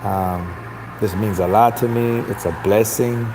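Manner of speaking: mock a humble slow talker, drawn out and low; Andy Ruiz. Host shows how the fighter fooled everyone, mellow and humble. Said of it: "he talks mellow. He's a mellow talker".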